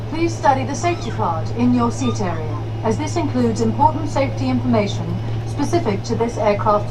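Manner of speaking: intense. Acted. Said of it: "very intense. I love the soured face".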